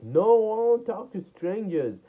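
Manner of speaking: high-pitched mock rebuff, loud on the first word; imagined refusing girl. Storyteller imagining a girl turning down his greeting, high mock refusal. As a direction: put on a high voice and refuse indignantly. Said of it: "I thought she would be like".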